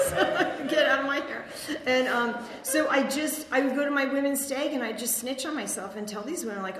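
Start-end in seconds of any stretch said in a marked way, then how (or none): 0.0-1.5 laughing. Real, not acted. Laughing at her joke.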